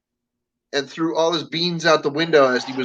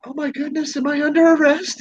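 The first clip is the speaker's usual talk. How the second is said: put-on high, dismayed old-lady voice; startled old lady; 9 semitones higher than usual. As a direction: gasp out the question. Old lady alarmed by cop.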